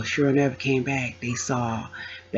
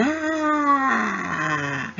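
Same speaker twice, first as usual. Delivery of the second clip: mock pain cry; 10 semitones higher than usual. Spasm pain cry retold.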